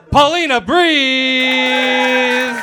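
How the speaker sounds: "Paulina Breeze" stretched very slow and loud, boxing-intro style; big drawn-out arena introduction; ring announcer. Shown as a demonstration. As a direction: belt out the name like a fight announcer. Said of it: "let me do it clean for the audio".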